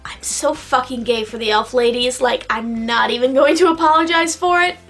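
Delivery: usual speaking voice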